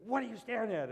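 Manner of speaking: imitating a stranger's angry challenge; angry lady. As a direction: confront him like the irritated shopper. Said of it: "She goes".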